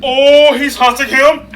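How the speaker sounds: high, loud panicked cries; frightened shouting. Real, not acted. Realising the ghost remembers him, a loud scared yell. Scared reaction to story twist.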